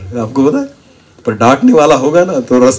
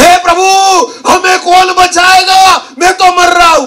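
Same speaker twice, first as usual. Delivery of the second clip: crying out. Retold or imitated. Believer in big trouble crying to God, panicked shout. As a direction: scream for rescue as if dying. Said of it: "चिल्ला चिल्ला".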